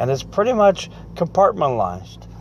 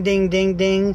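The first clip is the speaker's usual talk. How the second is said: higher sing-song ding ding ding; mimicked phone ding; 6 semitones higher, slower than usual. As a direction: chime it like a phone going off. Imitating phone notification sounds.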